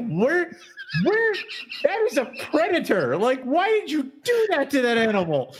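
real exasperation about the poor snake; indignant disbelief. Real, not acted. Co-host ranting about snakes in hats, indignant and high.

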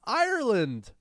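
mock outrage. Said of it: "What a racist thing to say".

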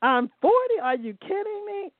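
high, incredulous "Really?" as if told a silly age; playful mock astonishment; mock disbelief. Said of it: "we should all be able to say".

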